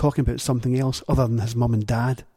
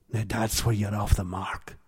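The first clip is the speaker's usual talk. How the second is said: ordinary delivery, nothing marked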